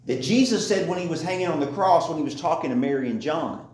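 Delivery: ordinary talk, nothing marked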